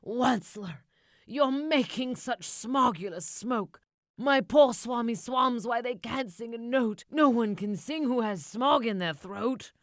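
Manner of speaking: high, loud scolding creature voice; high loud Lorax complaint. A narrator's reading. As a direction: scold him like a fussy guardian.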